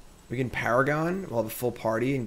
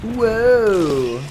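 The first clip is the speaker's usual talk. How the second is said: loud high startled whoa; surprised; 11 semitones higher, 9 dB louder than usual. Real, not acted. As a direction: caught off guard, loud. Verrast door tegenzet.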